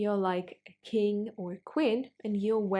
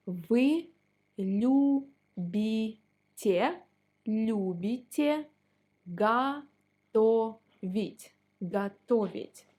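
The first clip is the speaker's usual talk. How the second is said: slowed practice drill; 3 dB louder than usual. Shown as a demonstration. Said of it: "you can say".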